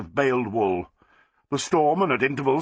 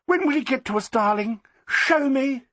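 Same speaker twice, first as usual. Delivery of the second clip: high, gushing society lady; Flossie, eager high voice; 12 semitones higher than usual. A narrator's reading. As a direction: gush upward like a fussy socialite.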